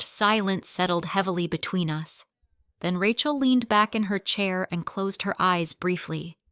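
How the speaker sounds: ordinary delivery, nothing marked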